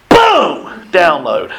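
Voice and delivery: a loud, high exclamation; sudden outburst. Retold or imitated. Speaker reliving the moment inspiration hit him. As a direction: shout it as the idea hits.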